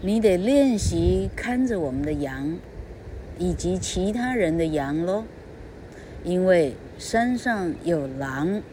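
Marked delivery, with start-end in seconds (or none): none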